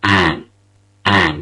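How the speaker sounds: "An" held long; drawn-out first syllable; long stressed an. Shown as a demonstration. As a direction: stretch the syllable "An". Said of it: "It's rather long".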